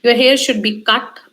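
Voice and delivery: ordinary talk, nothing marked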